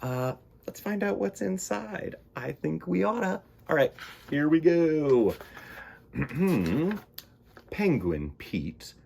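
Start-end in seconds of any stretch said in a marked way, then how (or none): 0.0-5.4 high, bright playful character. Acted. Playful high voice before reading. 6.1-7.2 theatrical throat clear. Shown as a demonstration. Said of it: "clears throat".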